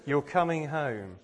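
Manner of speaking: loud, slow fatherly welcome; God welcoming home. Retold or imitated. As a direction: proclaim it slowly like a loving father calling his son home. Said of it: "but he'll say".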